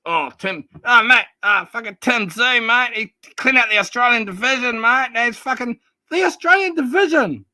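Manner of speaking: mocking an Aussie fan's gushing. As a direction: parody the gushing Tim fan, mate-heavy. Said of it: "You're like".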